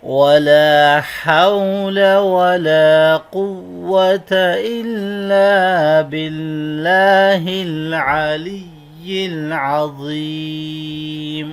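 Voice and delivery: slow, drawn-out Arabic recitation, word by word. Shown as a demonstration. Teacher reciting the kalima slowly for students to follow. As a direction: chant each word long for the class to repeat.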